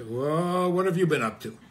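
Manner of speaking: religious folk gasping at a happy person, mock shocked; mock shocked disapproval. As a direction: play a scandalised pious onlooker, half joking. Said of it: "religious people see a happy person and say".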